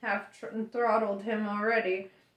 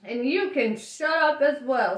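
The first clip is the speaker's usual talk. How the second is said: louder, higher irritated retort; snapped; 4 semitones higher, 6 dB louder than usual. A narrator's reading. Ford to his shouting captor, snapped. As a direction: snap back at the shouting guard. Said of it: "snapped Ford".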